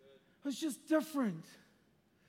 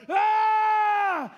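high, loud, sudden yell; retold yell; 10 semitones higher, 10 dB louder than usual. Preacher reliving the moment he cried out, loud yell. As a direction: let out a raw cry at the vision. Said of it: "I started yelling".

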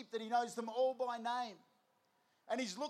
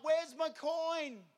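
drawn-out, higher mock cry; mock yell; 5 semitones higher, 4 dB louder, much slower than usual. Woman searching for her lost coin, mock desperate yell. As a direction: call it out slowly in pretend desperation. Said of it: "She yells out".

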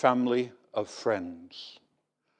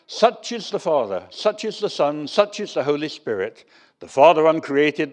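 louder chanting recital, speeding up on the list; rhythmic creed recitation; 2 semitones higher, 5 dB louder than usual. Retold or imitated. The preacher reciting the creed's list to the congregation, rhythmic and fast. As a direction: rattle the repetitions off faster.

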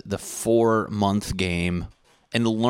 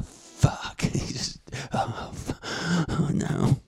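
flustered whisper; 10 semitones higher, slower than usual. Retold or imitated. Imagined flustered reaction.

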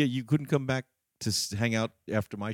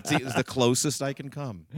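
normal talking voice

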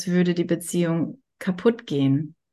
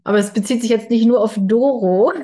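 giggling through the words, fast and loud; can't stop laughing while talking; 4 semitones higher, 7 dB louder, faster than usual. Real, not acted. Laughing at her own slip.

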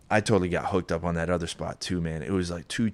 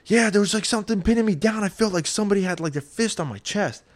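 much higher, strained freaked-out voice; a scared buddy squeaking out an accusation; panicked friend; 12 semitones higher than usual. Retold or imitated. Friend on the couch accusing him, high and panicked. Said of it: "He's like".

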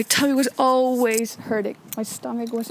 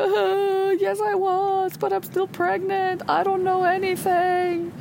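higher, helpless voice; flustered younger self; 8 semitones higher, slower than usual. Retold or imitated. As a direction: sound lost and defensive. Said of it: "I was like".

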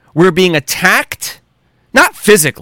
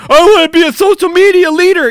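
mock crybaby; 8 semitones higher than usual. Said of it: "Stop crying like a big".

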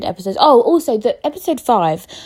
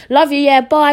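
brisk sign-off; 7 semitones higher, 7 dB louder than usual. Real, not acted. Cuts off her soppy moment.

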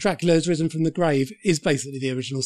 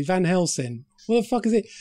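normal talking voice

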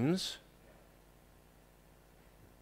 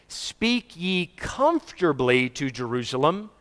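slow, easy-on-the-ear delivery; comfortable, gentle; 4 dB quieter, slower than usual. Acted. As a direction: soothe them with it, unhurried. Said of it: "this time you're saying it comfortably".

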